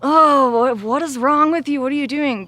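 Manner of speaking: loud, high, exasperated snapping at her own heart; fed up, scold it like a pest; annoyed. Retold or imitated. Scolding her own heart when it hurts, annoyed and loud. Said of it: "my first response is usually, like, annoyed".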